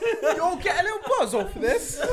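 put-on high, laughing British accent; mock British accent. Joining in on the bit, mock British accent. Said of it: "Why is that British as fuck".

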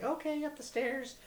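squeaky high mock clerk voice; Canadian shop guy. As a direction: toss it off like a stoner clerk. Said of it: "And I'm like".

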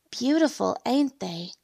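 put-on high, frail old woman voice; thin, weak elderly voice. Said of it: "a weak voice said behind me".

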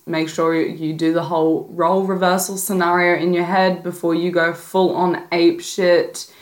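ordinary talk, nothing marked

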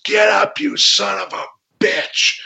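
high, strained yell, drawn out at the end; re-enacted angry taunt. Wrestler to the opponent on the mat, re-enacted angry taunt. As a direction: act out challenging a downed rival.

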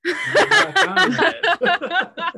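high cackling cowboy cheer; loud, breaking up with laughter; laughing. Real, not acted. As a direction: squeal it out through laughter. Laughing at the running joke.

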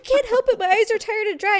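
mock defensive husband; defensive protest. Acting husband protesting to wife.

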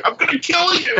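high squeaky joking threat; mock threat. Turning to the staff, high squeaky mock threat. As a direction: squeal a playful threat at the crew.

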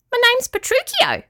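very high tiny creature; high-pitched little claw creature; squeaky little voice. A narrator's reading. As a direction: squeak it like a small critter. Claw creature gives its name.

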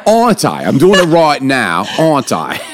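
put-on British accent drifting toward Irish. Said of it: "like, Irish".

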